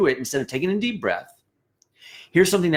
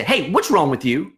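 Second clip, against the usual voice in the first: higher, louder accusing challenge; 5 semitones higher, 3 dB louder than usual. Shown as a demonstration. Leader accusing a bandmate, mock confrontational. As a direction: confront them with an edge. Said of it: "If I walk up to you and say".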